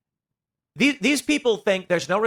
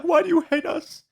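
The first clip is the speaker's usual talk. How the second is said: higher, whiny mock sob; mock crying Kimmel; 10 semitones higher than usual. Mocking Jimmy Kimmel's tearful plea, higher whiny mock. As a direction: whimper it like a crybaby host. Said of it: "Jimmy Kimmel crying".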